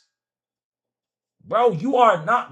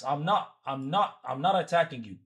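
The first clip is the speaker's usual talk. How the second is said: normal talking voice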